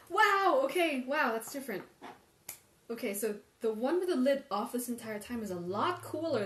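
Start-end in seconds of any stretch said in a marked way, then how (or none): 0.1-1.3 loud, high burst of surprise; surprised wow. Real, not acted. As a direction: blurt it out, genuinely startled. Surprise at the temperature difference.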